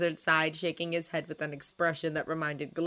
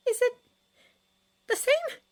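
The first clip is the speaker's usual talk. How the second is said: very high, hesitant question; high-pitched, tentative query; anxious friend; 6 dB louder than usual. A narrator's reading. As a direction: ask haltingly in a high voice. Friend asks timidly about bottle.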